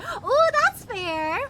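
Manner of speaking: ordinary talk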